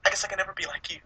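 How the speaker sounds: high, soft whiny voice; hammed-up jealous villain whimper; mock Hector Hammond. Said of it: "he's like".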